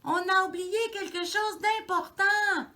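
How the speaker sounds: high, loud urgent shout; little mouse yelling high and loud; shouting as loud as he can. A narrator's reading. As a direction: throw every bit of voice at it. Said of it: "crie aussi fort qu'il peut".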